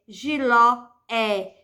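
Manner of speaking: giló slowed with the final ló stretched; stress on LÓ. Shown as a demonstration. Teacher drawing out the last syllable for the class, slow. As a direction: drag out the ló in giló. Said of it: "Agora, amor é".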